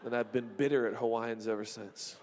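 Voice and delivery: ordinary delivery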